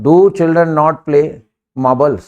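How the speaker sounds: slow, each word set apart; very slow model sentence. Shown as a demonstration. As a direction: dictate it word by word to students. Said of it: "children में S नहीं लगता है।". (बहुत धीरे, हर शब्द अलग)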